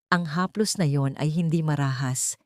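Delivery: ordinary delivery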